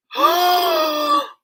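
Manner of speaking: sharp, high, loud gasp of shock. Retold or imitated. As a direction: gasp like the news just hit you. Shock at Susan leaving.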